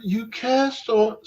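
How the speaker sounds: put-on high, slow prissy British accent; hammed-up posh British gasp; mock British accent. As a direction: play a shocked British prude, drawn out. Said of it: "Sorry for the British accent".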